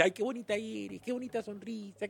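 imitating people who fawn over her looks, in a sugary voice; mock flattery. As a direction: coo it like a fawning admirer. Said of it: "cuando alguien te dice".

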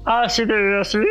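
singer's chesty voice, imitating the producer's demand; playful trial of a chesty, resonant voice; mock engolado voice, imitating. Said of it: "engole la voz así".

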